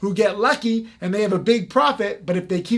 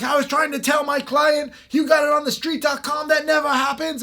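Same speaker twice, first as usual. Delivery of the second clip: higher, retold outburst of the British promoter; mock exasperated yell; 6 semitones higher than usual. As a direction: play his complaint as a hammed-up shout, pitched up. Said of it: "And he was like".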